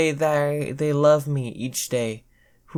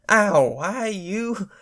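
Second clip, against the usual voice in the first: pained ow; 7 semitones higher, slower than usual. Acted. Hit by thrown book.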